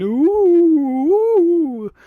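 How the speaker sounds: long, high, loud "ooh"; playful spooky howl; mock ghostly wail. Spooky effect for the story.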